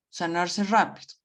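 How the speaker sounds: normal talking voice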